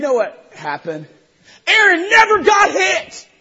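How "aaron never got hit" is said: much higher, louder, slow triumphant call; shouted punchline. Real, not acted. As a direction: nail the punchline to the crowd.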